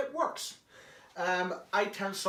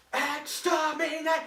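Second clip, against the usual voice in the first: high, slow shouted exterminate; Dalek shout; 11 semitones higher, 5 dB louder, slower than usual. Shown as a demonstration. Showing how to shout into the mic, Dalek shout. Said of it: "right up like that and going".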